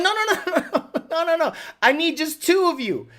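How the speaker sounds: high, flustered refusals; flustered protest. Retold or imitated. Driver stopping day workers piling in, flustered. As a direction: fend off a crowd in mock panic.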